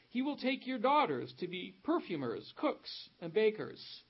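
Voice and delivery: emphatic, projected Bible reading; scripture reading. Real, not acted. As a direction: read the prophet's warning out to a congregation. Reads scripture aloud to congregation.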